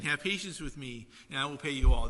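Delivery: ordinary delivery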